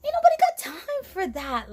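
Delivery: high, loud retold brush-off; hammed-up high-voiced refusal; sassy dismissal. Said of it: "I'm like".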